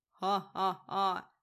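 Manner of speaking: slow, loud hammy evil laugh; badly faked villain cackle. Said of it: "злодейский смех как-то не очень вышел". (злодейский смех)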